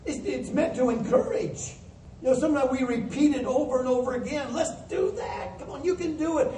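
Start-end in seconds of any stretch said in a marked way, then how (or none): 4.5-6.5 high-pitched coach urging the team on; pep-talk voice, pitched well up; encouraging coach. Acted. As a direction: cheer them on in a raised, coaxing voice. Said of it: "As the coach would to a team".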